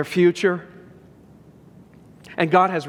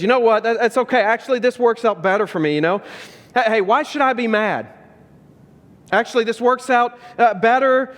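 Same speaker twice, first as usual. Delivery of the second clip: forced brave face; 7 semitones higher than usual. Retold or imitated. Will's bitter speech to Uncle Phil.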